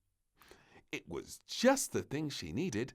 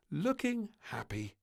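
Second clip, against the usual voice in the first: ordinary delivery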